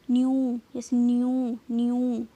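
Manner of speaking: न्यू loud, as "nyoo" with a y glide; yu sound demo. Shown as a demonstration. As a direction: hit न्यू each time, long "yoo" ending. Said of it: "sound आएगी ई यू की". (न्यू में यू की ध्वनि ज़ोर से)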